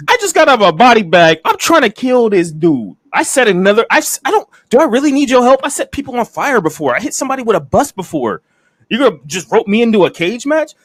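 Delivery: high-pitched, exasperated retold rant; mock indignation. Wrestler objects to a stranger speaking for him, mock indignation. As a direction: argue it as the affronted wrestler. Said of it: "And it's like".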